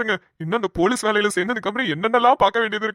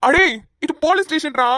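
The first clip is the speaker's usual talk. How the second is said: sharp, higher scolding cry; scolding outburst; 6 semitones higher than usual. Acted. Policeman scolds the couple for asking for one room, raised voice. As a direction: scold them in disbelief.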